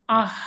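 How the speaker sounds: "আহ" pushed up from deep inside; deep throat sound. Shown as a demonstration. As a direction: breathe আহ from the chest. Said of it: "একেবারে ভিতর থেকে উঠে আসবে".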